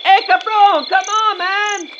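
high, drawn-out holler to a friend; calling out. Acted. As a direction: yell for a buddy to come over. Calling out to Landon.

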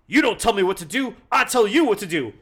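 loud, fast blustering tough guy; Farouk impression. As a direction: bark it like a cocky wrestler. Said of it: "Farouk is literally saying".

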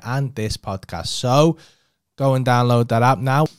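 loud, bright sponsor read; podcast ad read. Shown as a demonstration. Host reads his sponsor break for listeners, upbeat. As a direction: sell it like a radio ad. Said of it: "I normally do a little ad break".

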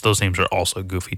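ordinary talk, nothing marked

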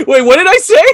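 laughing. Real, not acted. Laughing at being teased.

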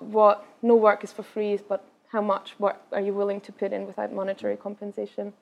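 usual speaking voice

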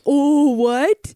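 mocked Minnesotan accent. Imitating in-laws teasing her, exaggerated Minnesotan accent. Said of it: "make fun of my, like".

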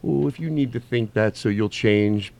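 normal talking voice

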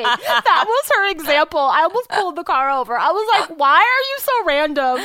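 barely holding it together from laughing; still laughing hard. Real, not acted. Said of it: "crying, laughing".

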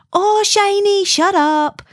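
high, loud, bad Scottish accent imitation; badly faked Scottish cry, pitched high; fake Scottish accent. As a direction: imitate a pal scolding in mock Scottish. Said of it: "in a proper Scottish accent and not my fake".

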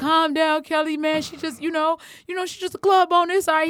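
high, loud imitation of a friend soothing; imitating Bald Head. Host imitating friend calming her outside the club, urgent reassurance. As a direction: talk someone off the ledge, urgently. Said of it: "He's trying to talk me off the ledge".

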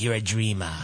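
deep stern Norwegian dad; low father voice. Retold or imitated. Father Bjorn voiced in story.

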